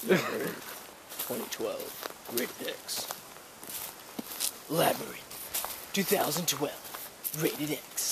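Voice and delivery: slow, drawn-out excited trailer read; too excited, like a surfer movie. Shown as a demonstration. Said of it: "that's too excited. That's like a surfer movie voice".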